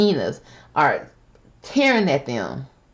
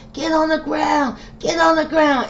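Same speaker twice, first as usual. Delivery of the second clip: high mock cop shout of get on the ground; mocking the officers yelling at the man; imitated police yelling; 11 semitones higher than usual. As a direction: bark it like an aggressive officer, pitched way up. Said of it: "They were just yelling".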